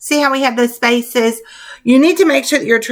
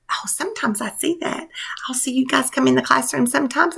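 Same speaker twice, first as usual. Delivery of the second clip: hushed, breath-only aside; soft whispered remark; 7 dB quieter than usual. Acted. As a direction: whisper it to the class like a confidence. Teacher's confiding aside to pupils.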